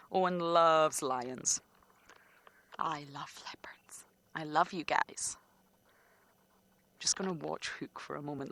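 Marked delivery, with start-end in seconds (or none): 2.8-8.5 wildlife watching whisper. Real, not acted. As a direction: whisper so you don't disturb it. Whispers near leopard.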